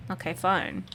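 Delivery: normal talking voice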